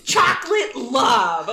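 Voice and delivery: slow, high, loud and laughing; proud line. Retold or imitated. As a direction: announce the name of the look with glee. Quoting Becky naming her look.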